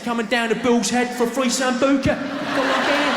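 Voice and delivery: hammed-up English pub tout, hyped; pub promoter. Comedian imitates a bar tout luring tourists, hyped. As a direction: sell free shots like a cheesy bar promoter. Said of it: "outside a pub in Tenerife or somewhere going".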